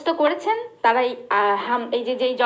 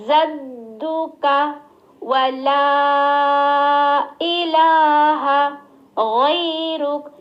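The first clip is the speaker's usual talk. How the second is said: very slow and louder, long stretched লা; slow recitation; 5 dB louder, much slower than usual. Shown as a demonstration. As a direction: hold the long vowels out. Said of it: "তিন আলিফ টেনে".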